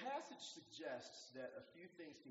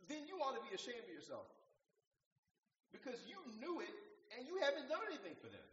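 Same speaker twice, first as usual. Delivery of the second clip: raised, pitched-up scolding; livid father; 11 semitones higher, 6 dB louder than usual. Retold or imitated. Booth scolding his son for ignoring the poor, raised and heated. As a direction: shame him for doing nothing. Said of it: "His father was livid about this".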